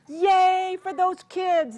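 slow, high cheering. Real, not acted. Cheering for the children.

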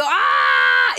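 loud low shriek instead of a laugh; screech of laughter. Retold or imitated. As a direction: yell "Ah!" as if something is hilarious. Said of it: "you don't even laugh. You go".

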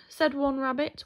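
lively picture-book storytelling; reading to children. A narrator's reading. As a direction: tell the story to small children, animated.

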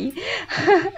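soft embarrassed giggle; a quick quiet self-mocking laugh; short laugh. Real, not acted. Vlogger laughing at herself for being stingy, soft giggle.

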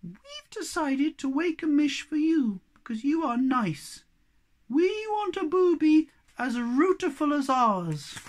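very high, slow little voice; slow, high-pitched tiny creature making an announcement; solemn Bob. Acted. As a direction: pipe it up with earnest gravity. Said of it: "Then Bob said solemnly".

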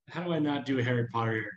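normal talking voice

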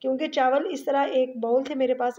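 normal talking voice

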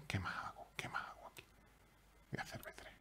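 whispering to himself. Real, not acted. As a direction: think aloud in a hush. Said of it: "susurra sus jugadas". (susurro pensativo)

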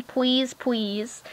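PLEASE with W in place of L; cutesy pwease. Shown as a demonstration. Telling viewers what to type, PLEASE said as cutesy "pwease". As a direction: say PLEASE as "pweeze". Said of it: "P W E A C".

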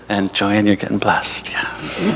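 hushed whisper to one listener; whispered aside. Real, not acted. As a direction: lean in and whisper it to Joanne. Aside to Joanne in class.

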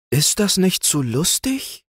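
high, worried question from a fretful widow; anxious. A narrator's reading. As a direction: ask it with a mother's anxious doubt. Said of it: "fragte die Witwe ängstlich".

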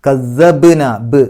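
slow, loud കസ്സബ്ന with a bounced b; echoed consonant. Shown as a demonstration. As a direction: release the ബ് of കസ്സബ്ന distinctly. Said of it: "എടുത്തു പറയാം". (കസ്സബ്ന: ബ് എടുത്തു പറയുക)

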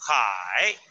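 Hai low and dipping; third tone. Shown as a demonstration. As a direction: let Hai sink deep, third tone. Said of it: "Tercero ahí te va".

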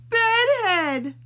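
huge high shout of dread; dramatic shout. A narrator's reading. Reader shouting the hair's name to children. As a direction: blast the word out like a horror reveal. Big reveal of the monster hair.

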